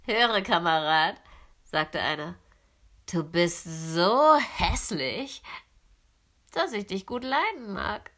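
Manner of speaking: brash wild gander calling out; a bold gander inviting the duckling along, loud and cocky; cheeky gander. A narrator's reading. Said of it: "Die waren sehr keck".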